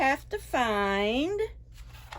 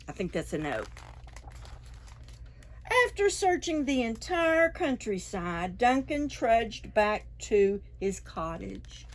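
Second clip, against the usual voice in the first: ordinary delivery, nothing marked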